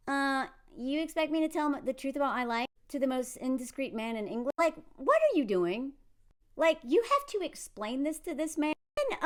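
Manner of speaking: usual speaking voice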